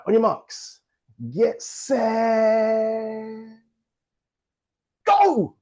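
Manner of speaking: rising call, go shouted high; race starter call. Shown as a demonstration. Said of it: "race starter call".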